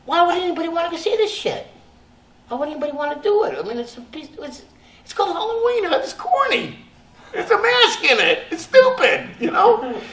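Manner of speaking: high, fast, mocking rant; scornful rant. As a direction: scoff at the movie fast and high. Retold scornful inner rant.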